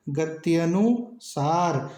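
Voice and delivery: गत्यनुसार spoken slowly, each syllable clear; slow correct pronunciation. Shown as a demonstration. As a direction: spell out गत्यनुसार slowly, like a teacher. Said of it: "यह शुद्ध शब्द है". (गत्यनुसार धीरे-धीरे, हर अक्षर साफ़)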